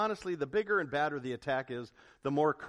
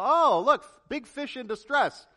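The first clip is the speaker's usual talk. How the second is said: voicing the shark; 8 semitones higher, 7 dB louder than usual. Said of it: "signals for that shark to go".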